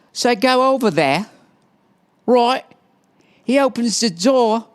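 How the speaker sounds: high-pitched, hammed-up English accent. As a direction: put on a silly high English voice. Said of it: "why she has an English accent".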